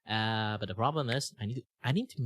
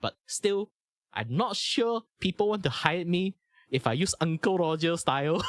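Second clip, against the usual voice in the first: Uncle Roger style; 7 semitones higher than usual. Shown as a demonstration. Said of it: "channel my inner Uncle Roger".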